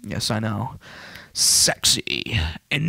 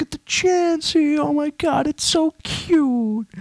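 much higher, slow cooing voice; cutesy squeal. Real, not acted. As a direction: coo over something adorable. Cooing over a cute Pokemon.

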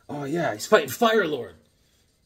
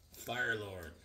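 ordinary talk, nothing marked

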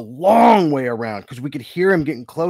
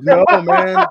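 much higher, louder no; loud refusal; 11 dB louder than usual. Retold or imitated. Shopper refusing the kid, loud and high. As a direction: blurt it out high. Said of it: "I was like".

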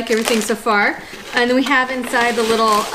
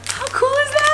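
excited. Real, not acted. Delighted by frog-shaped chew.

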